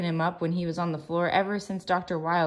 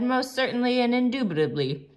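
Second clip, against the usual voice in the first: pompous; 7 semitones higher, 4 dB louder than usual. A narrator's reading. Steve voiced, pompous words.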